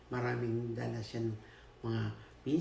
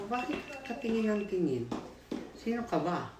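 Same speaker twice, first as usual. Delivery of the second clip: retold angry challenge; 9 semitones higher than usual. Girl confronting the staring man at work, mock angry. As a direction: snap at him like a bothered teen. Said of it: "Nagalit ako".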